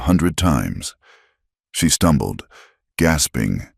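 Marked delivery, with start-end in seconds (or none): none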